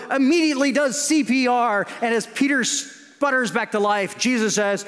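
hammed-up action-movie retelling, pitched high; breathless mock-epic play-by-play; mock heroic narration. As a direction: tell it like a frantic, over-dramatic rescue scene. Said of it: "if Jesus was scolding Peter".

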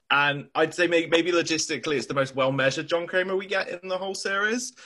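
ordinary delivery

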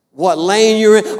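ordinary delivery

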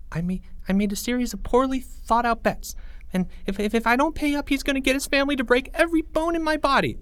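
put-on high, rushing frightened boy; high boy voice speeding up in fear; panicky kid voice. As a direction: babble it out in a panic. Panicked boy explains his debt.

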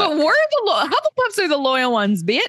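high-pitched indignant correction; mock outrage. Real, not acted. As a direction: jump in with playful indignation. Playfully objecting to co-host's claim.

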